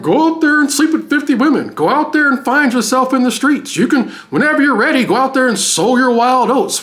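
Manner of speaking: high-pitched mocking pep talk; high, insistent, sarcastic urging; mock advice. Mockingly voices advice given to men.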